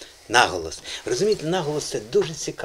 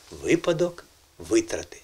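"випадок" and "витрати" with ВИ strong in each; correct first-syllable stress; 2 semitones lower, 3 dB quieter than usual. Shown as a demonstration. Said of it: "Запам'ятайте".

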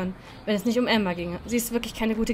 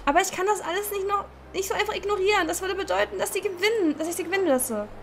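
upset pleading; 8 semitones higher than usual. Acted. Pleading against ignoring Debra.

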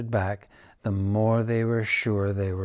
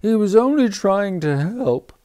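high, loud, tearful cat voice; weeping cat; 10 semitones higher, 7 dB louder than usual. Acted. The cat weeps over supper about the duck. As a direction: wail it through tears. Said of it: "wept the cat".

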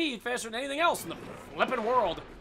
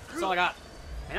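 normal talking voice

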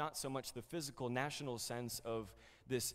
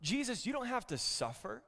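higher, anxious protest; pleading Peter; 8 semitones higher than usual. Retold or imitated. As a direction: beg him not to go. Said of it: "So Peter said".

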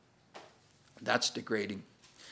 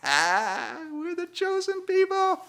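mock smugness; much slower than usual. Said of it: "they're like".

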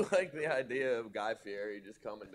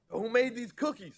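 high, loud mock demand; mock Subway crasher; 13 semitones higher, 5 dB louder than usual. Friend mimics the Subway guy demanding, high and loud. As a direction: shout it into the kitchen. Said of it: "The dude that would go in Subway and be like".

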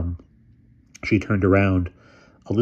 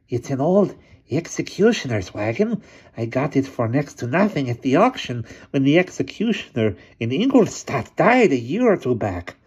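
hunchback assistant; 7 semitones higher than usual. A narrator's reading. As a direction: speak like a sly old hunchback.

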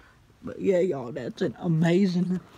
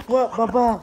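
loud, higher-pitched greeting to the dog; excited dog greeting; 5 semitones higher, 9 dB louder than usual. Real, not acted. Vlogger greeting a thrilled dog, excited. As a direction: call out excitedly to your dog.

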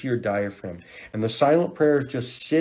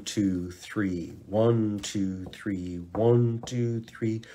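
ordinary talk

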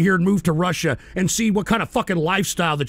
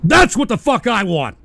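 louder, higher fired-up yelling; angry shouting; 4 semitones higher, 5 dB louder than usual. Real, not acted. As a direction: hammer it home with fury. Patriotic rant.

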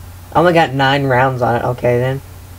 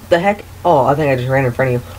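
ordinary talk

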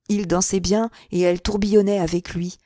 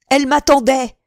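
high, loud yell, louder the second time; shouted "Elle m'attendait", building; jealous shouting; 6 semitones higher, 8 dB louder than usual. Acted. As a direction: bellow it to anyone who'll listen. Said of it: "criait-il".

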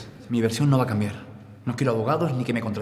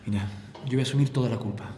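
usual speaking voice

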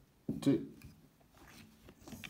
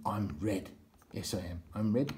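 ordinary delivery

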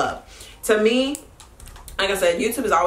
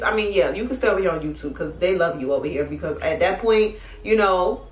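ordinary talk

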